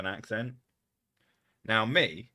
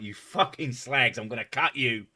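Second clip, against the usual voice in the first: pissed-off Cockney; 3 semitones higher, 5 dB louder than usual. Acted. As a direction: play an angry Cockney, punching the words. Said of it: "Cockney accent is my pissed off accent".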